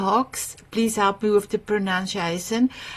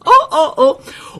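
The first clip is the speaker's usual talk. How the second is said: high, slow oh oh oh through laughter; real giggling oh oh oh; laughing oh oh oh; 8 semitones higher, 7 dB louder, slower than usual. Real, not acted. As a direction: laugh out each oh. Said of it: "then I go like this".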